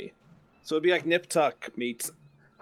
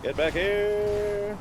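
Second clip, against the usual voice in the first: higher, drawn-out taunt; slow drawn-out call; 7 semitones higher, much slower than usual. Real, not acted. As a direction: stretch it out chasing. Chasing in game.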